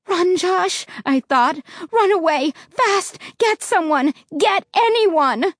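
high urgent pleading; desperate plea. Acted. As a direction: silently beg him to run.

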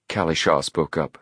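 plain reading voice

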